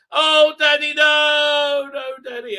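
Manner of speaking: high, loud melodramatic wail; over-the-top pleading, fading at the end; mock anguished cry. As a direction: ham up a child's dismayed shout. Voicing the son's dismay.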